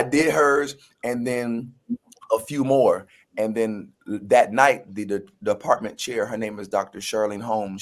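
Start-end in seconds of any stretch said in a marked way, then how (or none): none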